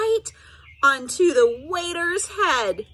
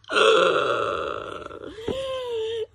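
dramatic gasp; 8 semitones higher than usual. A narrator's reading. Spaghetti disaster gasp.

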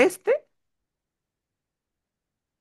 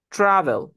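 travel with TRA stressed, then falling away; stress on first syllable; 3 semitones higher, 4 dB louder than usual. Shown as a demonstration. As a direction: punch the start: TRA-vel. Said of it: "tiene la fuerza de voz al inicio". (travel con la fuerza de voz en TRA, al inicio)

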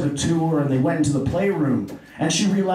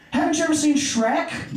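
mock Oprah amazement; 9 semitones higher than usual. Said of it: "She's like".

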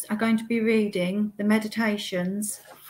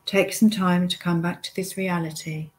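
guided meditation. Acted. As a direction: lead the listener step by step, unhurried, pausing often.

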